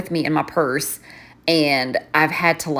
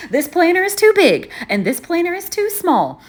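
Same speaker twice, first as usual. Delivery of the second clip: laughing; 12 semitones higher than usual. Real, not acted. Laughing through Goldilocks joke.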